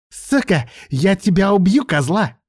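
high, loud threat; furious yelling. A narrator's reading. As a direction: yell the threat in a rage. Enraged prisoner threatens to kill.

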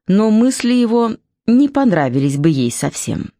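usual speaking voice

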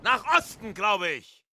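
high-pitched, alarmed protest; aghast. Acted. English captain answering the raging Ahab, aghast. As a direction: cry out at a man gone mad.

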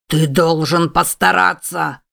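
slow, firm growl. A narrator's reading. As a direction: press him on with a growl. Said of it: "зарычал лев".

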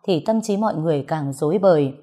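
usual speaking voice

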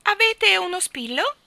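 very high, loud question; Miss Calicò's squawk. Acted. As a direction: pipe up loudly at a passer-by. Calicò accosts a passer-by.

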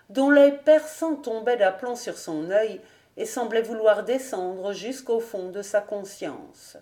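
plain reading voice

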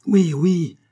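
high, loud cheer of agreement; crowd crying out. A narrator's reading. Women and soldiers agree with Billot, crying out. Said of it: "s'écrièrent de tous côtés des voix de femmes et de soldats".

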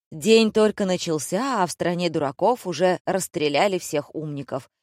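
plain reading voice

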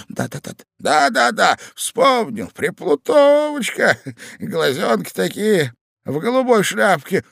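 high, loud, drawn-out recollection; delighted old man. A narrator's reading. Old husband recalling the pretty lady to his wife, delighted.

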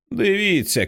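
mild narrated shout. A narrator's reading. Ralphie pointing toward the shelf, mild high-pitched shout. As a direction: pitch it up like a boy calling out. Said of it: "кричить він".

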